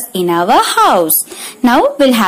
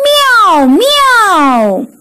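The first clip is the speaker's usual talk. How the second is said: cat meow; 8 semitones higher than usual. Retold or imitated. Teacher shows children a cat's sound, very high meow. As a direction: mew like a little cat. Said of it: "And the cat says".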